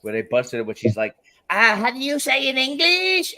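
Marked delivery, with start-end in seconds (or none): 1.5-3.3 high, exaggerated fake Spanish accent; mock Spanish accent. Mocking the wife's TV appearance in a hammed-up fake Spanish accent. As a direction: ham up a terrible put-on Spanish accent. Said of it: "her accent was terrible".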